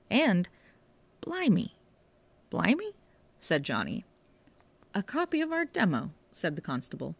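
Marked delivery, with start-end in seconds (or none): 1.2-1.7 high awed gasp of a word; amazed. Acted. Constable amazed at rare record. 2.5-2.9 high questioning echo; puzzled echo. Acted. Johnny echoes, puzzled. 4.9-6.1 surprised. Acted. Constable surprised at own demo.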